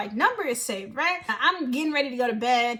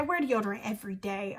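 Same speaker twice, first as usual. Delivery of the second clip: lower, softer stern warning; quiet and serious; 6 semitones lower, 7 dB quieter than usual. Real, not acted. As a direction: drop the voice for a firm warning. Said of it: "I'm not playing with y'all".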